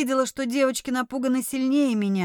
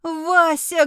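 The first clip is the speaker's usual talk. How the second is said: high, loud anguished call; bitter cry; 6 semitones higher, 5 dB louder than usual. A narrator's reading. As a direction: cry out a name in grief. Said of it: "горько закричала Виена".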